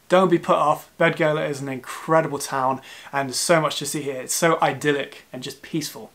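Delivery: ordinary talk, nothing marked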